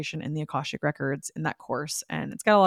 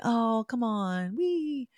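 slow, whiny plea rising much higher; pleading whine; 5 semitones higher, 4 dB quieter, much slower than usual. Retold or imitated. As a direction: drag it out like a coaxing child. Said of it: "But I was like".

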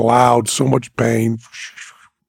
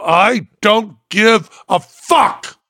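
slow, high, word-by-word defiance; emphatically spaced-out retort; defiant; 9 semitones higher, much slower than usual. Retold or imitated. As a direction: draw out each word, pitch raised. Retold defiant reply to doctor.

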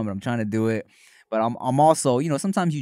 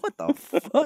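high-pitched shocked outburst; retold disbelief, pitch way up; stunned; 13 semitones higher, slower than usual. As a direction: blurt it in disbelief.

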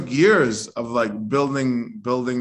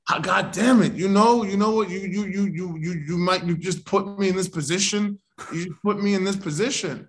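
a higher-pitched, sputtering cop playing the victim; mock indignant cop; 6 semitones higher than usual. A man retelling a stop mocks the officer as whining victim, pitched high. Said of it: "He was like, he was the victim".

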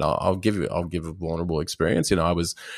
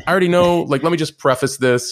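higher and louder, eager to get it out; rushing her case to God, voice up high; eagerly prefacing a prayer, raised voice; excited plea to God; 9 semitones higher, 6 dB louder than usual. Retold or imitated.

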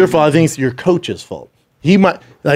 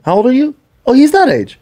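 high, louder mock excited questioning; retold eager surprise; 10 semitones higher, 4 dB louder than usual. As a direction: pitch it way up while replaying the exchange. Retold challenge to a boastful friend.

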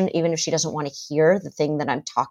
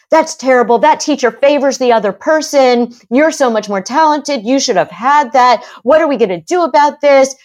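put-on louder, higher indignant mother siding with her child; outraged mom; 6 semitones higher, 7 dB louder than usual. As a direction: play the furious mom ranting about the unfair teacher. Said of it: "what the daughter hears is".

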